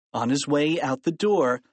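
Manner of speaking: usual speaking voice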